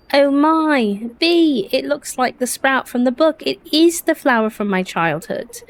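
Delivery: amazed excitement. Acted. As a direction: gasp with wonder at a discovery, pitch up. Character sees the sprout appear.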